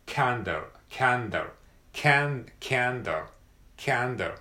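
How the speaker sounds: Candle said like can plus dl; candle drill. Shown as a demonstration. Teacher drilling candle for learners. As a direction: repeat candle, can then a quick dl. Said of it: "Yes, I canのcanっていう感じで".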